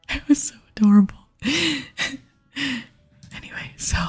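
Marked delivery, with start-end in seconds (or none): 1.4-2.2 breathy amused laugh; whispered giggle. Real, not acted. Amused recalling fiance's gift, breathy giggle.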